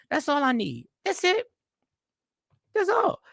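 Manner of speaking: high-pitched, insistent and simple. Acted. Customer at the takeout counter, insisting in a high voice. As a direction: squeak it out like a fussy customer.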